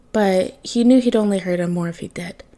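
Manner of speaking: usual speaking voice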